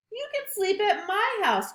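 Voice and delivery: high, calling-out beaver; high friendly Beaver. Acted. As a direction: call out the offer. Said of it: "called Beaver".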